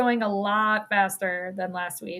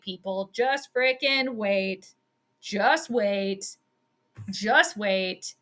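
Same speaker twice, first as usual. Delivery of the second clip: normal talking voice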